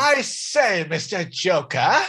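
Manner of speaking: high-pitched, hammed-up British gentleman; bad British accent. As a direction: put on an over-polite, amateur British accent. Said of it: "I can't do a British accent".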